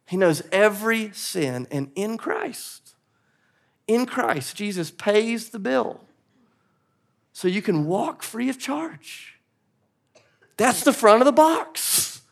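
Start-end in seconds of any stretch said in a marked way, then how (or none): 0.1-9.4 quiet preaching climax. Real, not acted. Sermon climax drops quiet.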